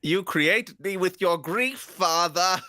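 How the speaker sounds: slow, high eerie puppet voice. Retold or imitated. Pinocchio telling his father he was born of grief, slow eerie voice. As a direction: lay it on like a haunting son.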